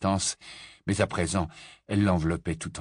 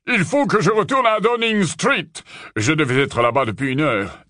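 firm, louder official voice; Kingsley's voice; 8 semitones higher, 6 dB louder than usual. Acted. Kingsley tells the group he must leave, firm and loud. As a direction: announce your departure with authority, loud.

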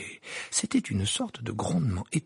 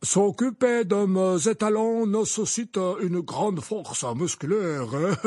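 higher, slow warning lady; grand lady voice; 8 semitones higher, 3 dB louder, slower than usual. Acted. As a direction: warn with a drawn-out stress. Madame Maxime voiced.

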